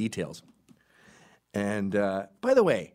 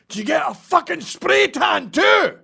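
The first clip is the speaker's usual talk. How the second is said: much higher, much louder, slow Scottish yell; shouted parting insult; 12 dB louder, slower than usual. Pretend. Walking away from the margarita guys, a loud high Scottish shout. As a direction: fire the insult back as you leave. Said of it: "I go".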